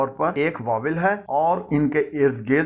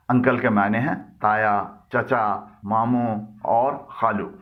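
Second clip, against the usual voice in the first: ordinary delivery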